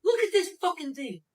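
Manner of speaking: ordinary talk, nothing marked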